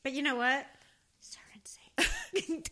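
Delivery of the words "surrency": slow, drawn-out eerie whisper; spooky whisper. Pretend. Co-host torturing her friend with the scary name, spooky whisper. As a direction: whisper it to creep someone out. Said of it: "I hated the whispery thing".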